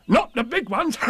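very high, bouncy tiger voice; Tigger. A narrator's reading. Tigger correcting Piglet about his size, high and boastful. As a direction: boast excitedly, ending in a laugh. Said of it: "said Tigger".

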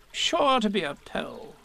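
high, slow, self-important. Acted. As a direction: ponder it pompously. Rabbit voice.

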